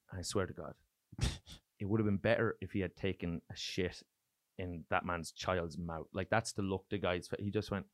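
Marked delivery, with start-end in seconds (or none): none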